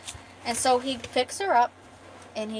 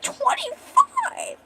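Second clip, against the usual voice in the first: very high, drawn-out squeal of disbelief; astonished; much slower than usual. Acted. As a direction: echo the number in exaggerated shock. Friends astonished at twenty-five glasses.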